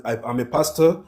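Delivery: normal talking voice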